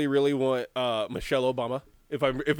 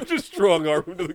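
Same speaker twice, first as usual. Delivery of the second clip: usual speaking voice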